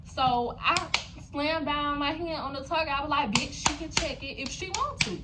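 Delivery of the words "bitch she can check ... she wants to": a snapped retort, voice pushed up; retold clapback; mock angry retort. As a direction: fire back at her. Retelling her own retort.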